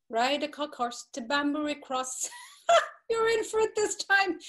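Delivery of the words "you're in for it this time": gloating laugh. Acted. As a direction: cackle the threat with delight. Bella gloating over Bloom.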